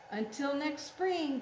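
ordinary delivery, nothing marked

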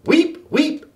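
high chirpy weep weep; happy guinea pig squeak. A narrator's reading. Guinea pig joining the noisy classroom, happy squeaks. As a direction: squeak along happily like a pet. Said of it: "cried Sammy, happily adding to the din".

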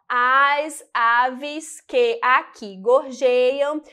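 slow, deliberate syllable-by-syllable reading. Shown as a demonstration. Teacher counting poetic syllables for students, syllable by syllable. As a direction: spell out each sound beat of the verse. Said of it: "Temos um, dois, três, quatro".

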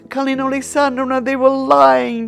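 imitating an overwhelmed woman in tears; retold crying. The architect's wife weeps on her shoulder, imitated tearful cry. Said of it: "crying on my shoulder".

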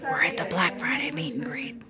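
hushed whisper, voice off. Real, not acted. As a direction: softly whisper it so nobody nearby hears. Whispering at a busy event.

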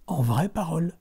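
usual speaking voice

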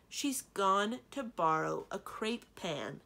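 slow, loud, over-enunciated. A narrator's reading. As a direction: spell it out loudly, word by word. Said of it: "repeated in a louder voice".

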